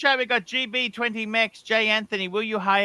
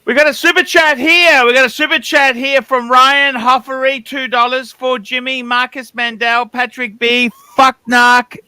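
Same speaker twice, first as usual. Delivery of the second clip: loud, projected donation read-out; big show-host read, punching the last words; super chat announcer; 8 dB louder than usual. Real, not acted. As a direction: announce it loudly to the audience.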